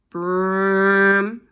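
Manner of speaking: loud vroom sound; engine noise. A narrator's reading. Narrator imitates the van engine, loud. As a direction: rev it like an engine.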